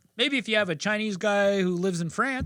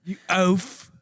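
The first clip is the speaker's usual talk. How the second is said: put-on British jab; mock British insult. Friend finishing a Gordon Ramsay impression, put-on British jab. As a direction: spit out the insult like a snooty chef. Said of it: "he's like".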